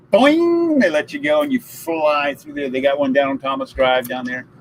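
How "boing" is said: high, loud sproing sound; spring it like a cartoon; cartoon boing. Bungee chair releases.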